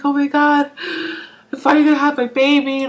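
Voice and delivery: high, mock-tearful exclamation; retold tearful joy. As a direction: imitate being overcome with happy tears. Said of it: "I started crying".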